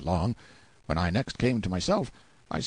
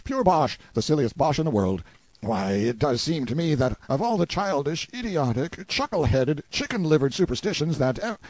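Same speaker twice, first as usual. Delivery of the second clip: higher, scornful exclaiming; mild shout; 6 semitones higher, 3 dB louder than usual. A narrator's reading. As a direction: pour out ridicule in a half-raised shout. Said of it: "and shouted".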